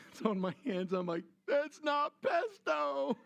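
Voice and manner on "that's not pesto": high and very slow, dawning disgust; horrified realization. Retold or imitated. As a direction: stretch it out as the truth hits. Realizing it's a dirty diaper.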